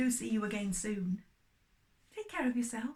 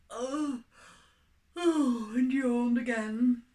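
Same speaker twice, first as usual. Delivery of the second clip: louder groaning yawns building up; yawning groans; 6 dB louder, much slower than usual. Acted. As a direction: groan through a big yawn. Dog yawns again.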